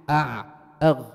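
أع then أغ, forced and loud from the throat; loud throat demo. Shown as a demonstration. Teacher exaggerating throat letters for the class, loud. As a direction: strain أع and أغ out with force. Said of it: "تسكن الحرف وتضع قبله همزة وصل".

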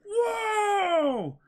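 put-on high, amazed boyish shouts; wide-eyed young pirate in awe; excited boy hero. S0 voices Luffy reading dialogue.

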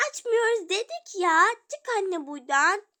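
high, loud shout; loud refusal. Acted. Children shouting at mother to leave. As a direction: yell through the door.

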